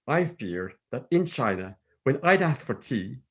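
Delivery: ordinary delivery, nothing marked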